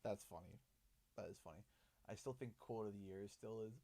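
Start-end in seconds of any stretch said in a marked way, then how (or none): none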